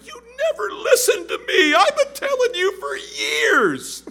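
hammed-up wife wailing her grievance; mock wife's outburst. Preacher playing the wife shouting at her husband, laughing crying shout. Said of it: "can you hear the argument here".

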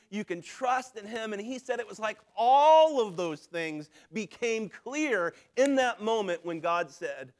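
normal talking voice

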